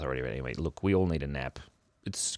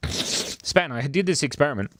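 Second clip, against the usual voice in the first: ordinary talk, nothing marked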